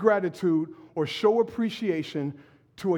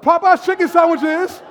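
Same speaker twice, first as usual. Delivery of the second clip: louder and higher, for the whole room; 7 semitones higher, 7 dB louder than usual. Shown as a demonstration. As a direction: read it out loud to the congregation. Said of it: "say it out loud".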